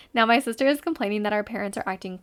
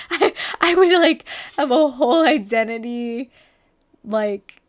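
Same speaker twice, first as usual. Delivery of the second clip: laughing while talking; 2 semitones higher, 7 dB louder than usual. Real, not acted. Laughing through the joke.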